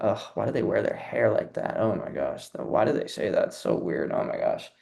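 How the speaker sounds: low, hushed, catty mutter of judgment; mock judgmental gossip. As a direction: sneer under your breath like a gossip. Judgmental inner voice mocked.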